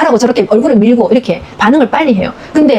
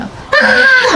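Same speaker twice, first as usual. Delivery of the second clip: high mock baby cry, half-laughing; imitated baby wail; 14 semitones higher than usual. Expert imitates the baby crying when put down, high mock wail. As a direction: squeal it like a fussy baby.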